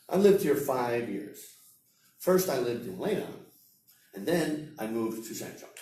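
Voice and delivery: short clear phrases, a pause after each; chunked with pauses. Shown as a demonstration. Teacher showing students chunking, phrases split by pauses. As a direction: break it up after "years" and "Leidan". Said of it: "sentences, pauses, that's chunking".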